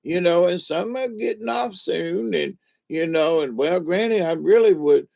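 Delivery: put-on high, creaky old granny greeting. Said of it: "she goes".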